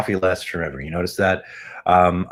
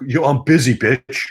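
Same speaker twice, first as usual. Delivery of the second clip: higher, louder dismissive snap; mock rude brush-off; 6 semitones higher, 6 dB louder than usual. Amazon voiced telling him off, loud and dismissive. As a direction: brush them off rudely. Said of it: "And you go like".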